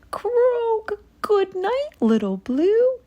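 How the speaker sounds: very high croaky goodnight; toad croak. A narrator's reading. Toad says goodnight to Blue, high croak. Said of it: "he said".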